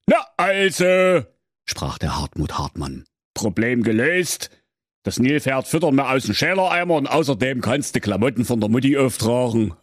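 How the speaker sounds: put-on father lecturing his teenage daughter; stern father. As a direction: scold her like a know-it-all father. Father settles it.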